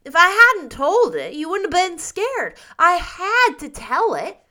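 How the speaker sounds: put-on high, loud, insistent child; frank little boy Davy. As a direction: justify it like an unrepentant small boy. Davy defends his falsehood.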